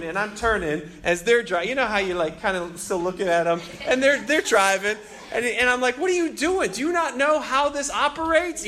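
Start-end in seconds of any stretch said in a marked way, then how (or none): 6.0-8.7 high-pitched indignant retold rant; venting. Riled-up pedestrian to the driving car, venting. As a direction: vent in exasperated disbelief. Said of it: "I just vented".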